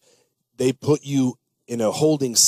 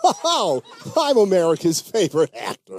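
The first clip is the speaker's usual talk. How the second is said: higher, folksy all-American movie star voice; Tom Hanks impression; 5 semitones higher than usual. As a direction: play the nice guy everyone loves. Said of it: "What would Tom Hanks say?".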